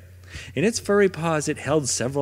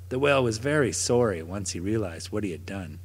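ordinary delivery

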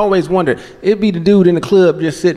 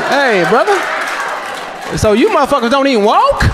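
higher, amazed and laughing; amazed questioning; 7 semitones higher than usual. Retold or imitated. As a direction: tease him in wide-eyed disbelief. Questioning floating man at club.

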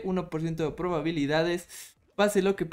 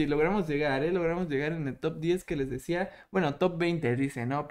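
usual speaking voice

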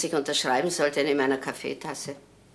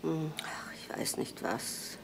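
slow, hushed whisper to herself; a sly whispered dodge; secretive whisper; 13 dB quieter, slower than usual. Acted. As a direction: keep it under your breath, almost to nobody. Old woman muttering to herself alone.

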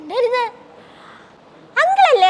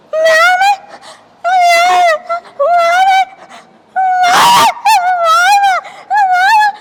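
shrieked, much higher and louder; screamed accusation; 11 semitones higher, 7 dB louder, much slower than usual. Acted. Child screams out who hurt her. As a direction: scream the name out in terror.

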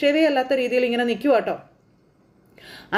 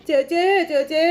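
higher, hammed-up cry of sister; mock wailing call; 5 semitones higher, slower than usual. Uncle bursting in, calling for sister with a hammed-up wail. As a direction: bawl out for sister, over the top. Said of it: "എന്ന് വിളിച്ച് car കൂവി".

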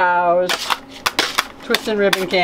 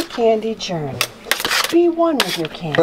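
soothing narrating voice; 2 semitones higher than usual. Acted. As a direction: lull them like a nature guide. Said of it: "Where'd that voice come from?".

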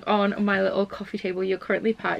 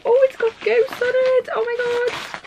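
high, loud squeal of delight; 5 dB louder than usual. Real, not acted. Thrilled vlogger opening a gift, squealing high. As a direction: gush over the ghost tape, way up high.

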